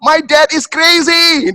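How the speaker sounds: high, loud, slow wail; imitated child's screaming. Toddler denouncing dad at the clinic, screaming. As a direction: cry out like an outraged toddler. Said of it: "dia teriaknya tuh saya nggak pernah".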